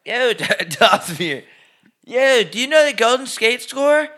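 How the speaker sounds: high, loud, slow bro voice; hammed-up bro soldier chatter; mock soldier bro. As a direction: play the dumb army bro. Acted soldier bro exchange.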